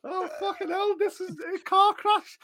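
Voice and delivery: high-pitched, slowing, cracked up at the mess; helpless exasperation. Real, not acted. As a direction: wail it, beaten by your own silliness. Helpless after the failed impression.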